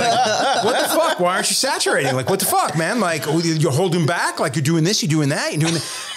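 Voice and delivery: high-pitched mock outrage through laughter; mock callout. Critics scolding him for holding back, high mocking voice. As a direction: ham up the disbelieving critics. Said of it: "a half dozen people being like".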